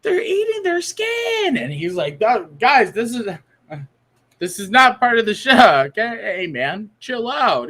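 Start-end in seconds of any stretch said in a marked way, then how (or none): 0.0-1.6 very high, slow panicked wail; terrified shriek. Pretend. Hypnotized man on stage shrieks in mock terror. 2.2-7.7 high, louder, flustered pleading; panicked hypnotist. Pretend. Hypnotist begs the screaming volunteer to calm down, panicked.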